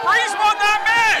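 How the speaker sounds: high, loud, slow insistence; outraged shout. Real, not acted. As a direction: hammer the protest home. Arguing with co-commentator.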